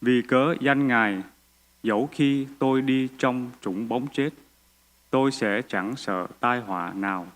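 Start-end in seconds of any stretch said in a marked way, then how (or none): none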